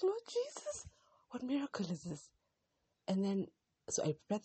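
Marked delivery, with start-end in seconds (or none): 0.0-2.3 much higher, swept up in wonder; a high, marvelling outburst; amazed exclamation. Retold or imitated. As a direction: cry out to the Lord in astonishment. Amazed at a miracle.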